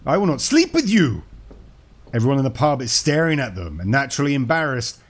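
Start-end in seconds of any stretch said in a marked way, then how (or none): 0.0-1.2 high, loud outraged joke yell; top-of-voice yell. Acted. Woman in a bar joke rejecting a shy guy, yelling. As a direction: yell it out as the joke's indignant woman. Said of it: "yelling at the top of her voice".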